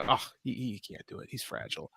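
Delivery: mock the phrase in a soft undertone; dismissive mimicry. Speaker quoting a phrase he hates, mock-dismissive. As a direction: toss it off under your breath, imitating. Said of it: "I hate that phrase".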